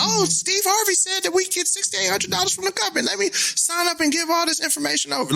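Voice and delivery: high, naive mom voice. Retold or imitated. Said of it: "So be like".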